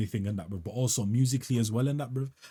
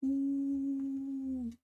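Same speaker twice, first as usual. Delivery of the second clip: a long, high, soft ooh of delight; high falsetto ooh; 6 dB quieter than usual. Real, not acted. Reacting to the beat with a high soft ooh. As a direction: float up into a soft amazed ooh.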